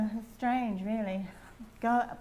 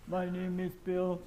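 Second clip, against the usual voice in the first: Flower Pot Man voice; 3 semitones lower than usual. Retold or imitated. Imitating Flower Pot Men character.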